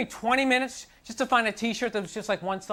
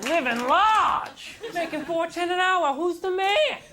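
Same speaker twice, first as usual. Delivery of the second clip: mock loser boast; 7 semitones higher, much slower than usual. Mock loser slogans, shouted.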